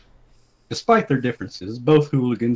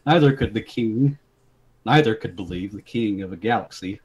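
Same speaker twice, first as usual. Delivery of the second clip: ordinary talk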